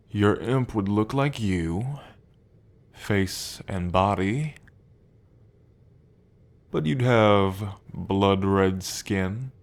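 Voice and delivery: slow, savoring each word of the threat; slow seductive menace. Acted. Demon slowly tempting the listener with their future imp form, savoring it. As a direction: linger over every word like you're tasting it.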